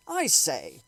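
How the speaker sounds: an astronomer calling over the crowd; Ogilvy calling out. Acted. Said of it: "said Ogilvy".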